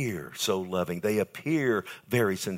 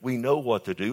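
usual speaking voice

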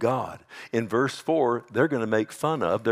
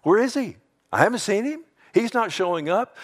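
high-pitched, louder taunt; mocking scoffer; 11 semitones higher, 4 dB louder than usual. Scoffer telling Peter he's not coming, high jeer. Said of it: "You know what mockers do?".

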